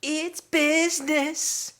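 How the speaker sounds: high, slow, crooning [name]; a slow, dreamy [name] croon; [name] impression. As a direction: croon it like a smooth love song. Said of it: "can you do [name]".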